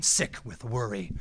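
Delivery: ordinary delivery, nothing marked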